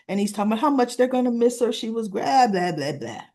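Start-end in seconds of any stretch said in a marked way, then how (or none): none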